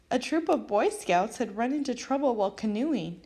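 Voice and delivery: ordinary delivery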